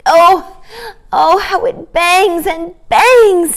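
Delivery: put-on a girl fretting over her aching ear; pained little girl. Jane complains about her sore ear, high and slow. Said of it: "clutching her ear again".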